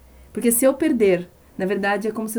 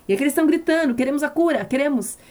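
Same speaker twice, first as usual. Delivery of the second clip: raised, high preaching voice; fervent preaching; 6 semitones higher, faster than usual. Acted. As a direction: preach it out with rising fervour. Preaching at full force.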